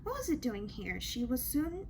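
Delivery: plain reading voice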